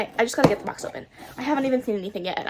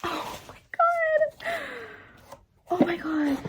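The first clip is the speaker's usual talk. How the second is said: choked-up cry; 13 semitones higher, 4 dB quieter, slower than usual. Real, not acted. First sight of the book.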